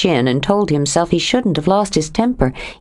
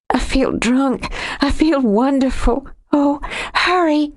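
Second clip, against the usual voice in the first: giddy; 8 semitones higher than usual. A narrator's reading. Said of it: "She was laughing now".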